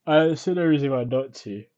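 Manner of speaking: talking through a yawn. Real, not acted. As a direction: yawn while speaking. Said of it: "Sorry, I'm long, yawning a lot".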